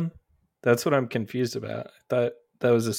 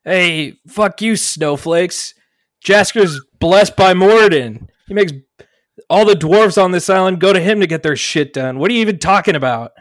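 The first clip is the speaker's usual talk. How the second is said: loud, higher, heckling the protesters; angry retort; 6 semitones higher, 11 dB louder than usual. Acted. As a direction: shout them down with contempt. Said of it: "I'm like".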